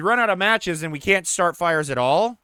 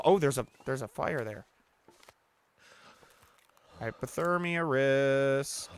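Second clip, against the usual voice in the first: usual speaking voice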